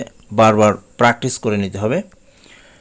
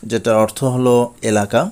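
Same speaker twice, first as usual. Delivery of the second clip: usual speaking voice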